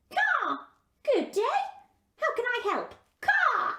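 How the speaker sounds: high, squawky bird voice with caws; a cheerful raven chattering; talking raven. Acted. Raven greets Gerda.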